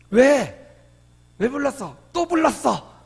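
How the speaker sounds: wife answering her husband, half-mock irritated; mock annoyed wife. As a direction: play the wife fed up with being called. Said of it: "때로는 짜증내고".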